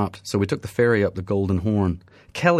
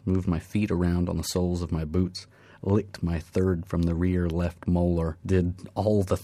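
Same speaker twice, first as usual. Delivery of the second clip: ordinary delivery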